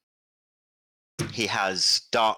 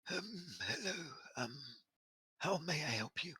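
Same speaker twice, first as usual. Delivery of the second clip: put-on soft, high, frail old servant voice; timid elderly butler, hushed; 8 semitones higher, 15 dB quieter, faster than usual.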